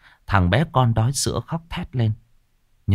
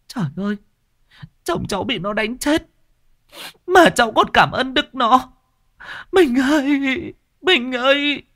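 wailing widow. A narrator's reading. Widow wailing for her dead husband.

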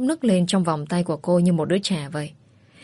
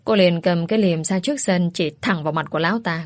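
plain reading voice

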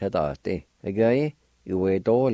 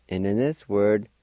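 ordinary delivery

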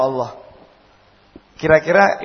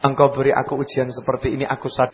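sincere prayer; 6 semitones lower, 7 dB quieter, faster than usual. Acted. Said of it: "coba pikir Allah".

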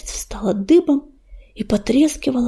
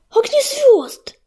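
high, slow, cracking whispered call; voice breaking; 11 semitones higher, slower than usual. A narrator's reading. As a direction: call his name with a breaking voice. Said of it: "срывающимся голосом прошептал Крутобок".

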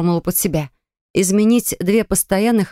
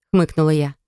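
ordinary delivery, nothing marked

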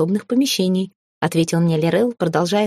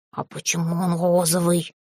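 amazed house spirit; 4 dB quieter than usual. A narrator's reading. House spirit stares at the pink dog, amazed. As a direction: ask it in hushed amazement. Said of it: "Домовой в изумлении".

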